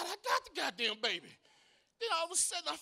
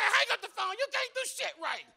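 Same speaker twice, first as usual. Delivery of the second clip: higher, dismissive ex voice; mock ex-wife snapping; 7 semitones higher than usual. Ex-wife snapping at him over the phone, higher and dismissive. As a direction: brush him off, annoyed. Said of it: "She said".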